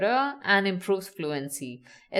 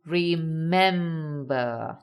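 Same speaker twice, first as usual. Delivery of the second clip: "Remember" very slow, with a long "mem"; stress on middle syllable; 4 semitones lower, much slower than usual. Shown as a demonstration. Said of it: "Stress is on mum".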